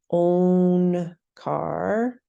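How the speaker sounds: slow "own car" with an N sound and no G; own car pronunciation. Shown as a demonstration. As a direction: say "own car" carefully, nasal n before the k. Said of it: "I learned this as".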